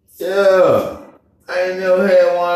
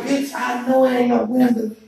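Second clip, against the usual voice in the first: retold shock; 7 semitones higher, slower than usual. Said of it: "I was like".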